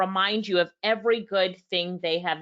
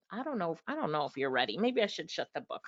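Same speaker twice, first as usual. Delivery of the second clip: soft, mock-hesitant musing; teasing doubt; 5 semitones lower, 9 dB quieter, faster than usual. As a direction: tease them quietly, as if having second thoughts. Teasing audience before next point.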